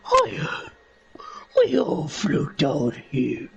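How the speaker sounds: creepy clown with a second voice underneath; slow, eerie clown line trailing into a long giggle; Pennywise. Shown as a demonstration. Showing listeners the hidden second voice, creepy clown. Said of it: "closely to Pennywise's voice that I do".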